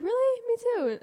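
squeaky mock voice. Friend acting out a lion pun, squeaky high voice. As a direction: pitch it way up like a cartoon. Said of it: "You're like".

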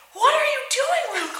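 high, loud, incredulous call; imitated alarmed call. Staff calling to friend in the ocean, incredulous shout. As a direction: call out in mock shock.

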